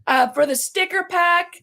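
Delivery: ordinary talk, nothing marked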